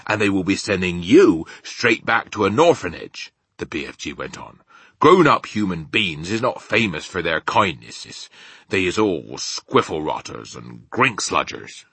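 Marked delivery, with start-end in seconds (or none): 0.1-3.3 emphatic, louder rustic giant; BFG, warning. Acted. Giant warning Sophie about the orphanage, emphatic. 5.0-11.8 emphatic rustic giant; BFG, disapproving. Acted. Giant running down human beings to Sophie, disapproving.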